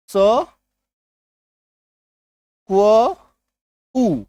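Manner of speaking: स, क्व, उ sounded as separate pieces with long pauses; letter by letter. Shown as a demonstration. Teacher shows why unblended letters sound odd, स, क्व, उ said separately. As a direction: pull the blend apart into स ... क्व ... उ. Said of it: "word to word लेके चलिएगा".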